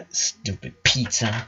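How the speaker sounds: soft, slow grumble; disappointed sister grumbling; muttered. A narrator's reading. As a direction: mutter it under your breath. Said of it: "muttered Lynn".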